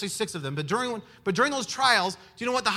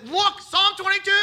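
pointing to the psalm; 11 semitones higher, 8 dB louder than usual. Retold or imitated.

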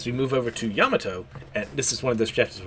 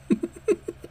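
high giggle. Real, not acted. Reader laughs at line.

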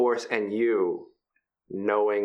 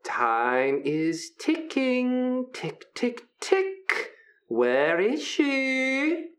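taunting prince; much slower than usual. Acted.

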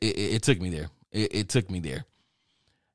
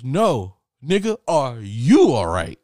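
loud, high comeback; acted-out loud disbelief; incredulous retort; 5 semitones higher, 9 dB louder, slower than usual. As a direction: throw the question back at full volume. Roommate fires the question back.

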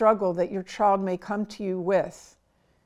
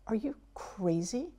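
harsh whispered outburst, held back; suppressed whisper; 9 dB quieter than usual. Retold or imitated. Parent biting their tongue, whispering in disbelief. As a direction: hiss it under your breath so the child can't hear.

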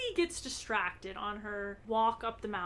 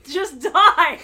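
loud exclamation; 5 semitones higher, 14 dB louder than usual. Real, not acted. Echoing the joke loudly.

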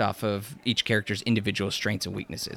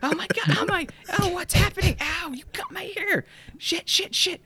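shrieking in pain; high, frantic, hurt squeal; panicked high voice. Acted. They're both screaming.

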